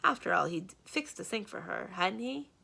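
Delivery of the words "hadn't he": ordinary delivery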